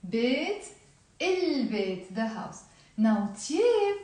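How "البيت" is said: البيت with a clear long L; pronounced L. Shown as a demonstration. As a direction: sound the article's L in البيت. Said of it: "we do pronounce the l".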